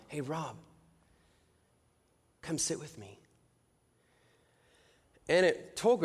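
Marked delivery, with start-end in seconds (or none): 0.1-3.2 very soft, unhurried invitation; quietly beckon, leaving space between phrases; God's gentle voice. Retold or imitated. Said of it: "I hear this voice".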